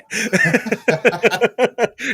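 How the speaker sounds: high, loud burst of laughter. Real, not acted. Laughing at the segue.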